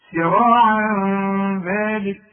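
سراعا ذلك drawn out slowly, tanwin hidden nasally before the dhal; تلاوة بطيئة; slow tajweed recitation. Shown as a demonstration. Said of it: "شوف".

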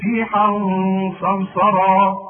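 ريحا صرصرا recited loud, tanwin hidden with nasal ghunna before the sad; تلاوة مجودة; loud tajweed recitation. Shown as a demonstration. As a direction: sound out ريحا صرصرا with a held hum. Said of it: "خد بالك من الغنة".

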